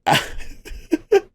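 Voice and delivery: breathless high giggle; real wheezing laughter; helpless laugh. Real, not acted. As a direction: crack up, can't hold it in. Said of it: "can't get through it without laughing".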